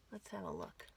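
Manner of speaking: hushed whispered aside. Acted. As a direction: lean in and whisper to the kids. Aside to child listeners.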